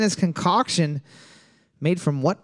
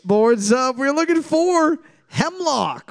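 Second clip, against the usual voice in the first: announcer call; 9 semitones higher, slower than usual. Acted. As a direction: boom it out like a game show host. Host reveal, high.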